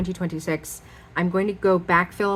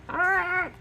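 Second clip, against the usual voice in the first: high, thin cry of dismay; frustrated wail; 12 semitones higher than usual. Real, not acted. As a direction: let out a high, upset "ah". Upset over the broken pen.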